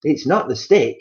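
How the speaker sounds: husband gloating over his wife's fear; Mister Twit grinning horribly. Acted. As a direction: gloat with a nasty grin. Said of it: "grinning horribly".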